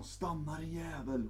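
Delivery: usual speaking voice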